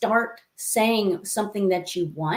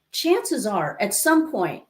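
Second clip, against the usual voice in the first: normal talking voice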